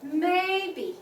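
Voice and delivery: high, held "may", then dropped "be"; first-tone "Maybe". Shown as a demonstration. Teacher shows English word has tones, high-level "Maybe". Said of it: "So what's the tone?".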